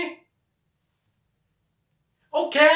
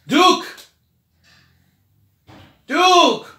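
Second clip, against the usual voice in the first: calling out; 5 semitones higher, 4 dB louder than usual. Acted. Calling the puppet friend.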